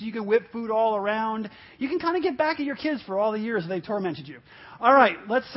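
usual speaking voice

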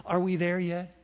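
low, soft first ask; kid whining. Retold or imitated. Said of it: "Do you remember this phrase".

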